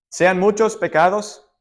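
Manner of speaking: ordinary talk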